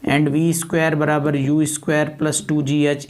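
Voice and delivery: usual speaking voice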